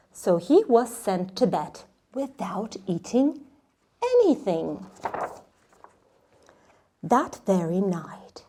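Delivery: lively picture-book read-aloud, hushed on the forest; reading to children. Acted. Teacher reading Where the Wild Things Are aloud to kids. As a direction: read with wonder, dropping to a whisper for the magic.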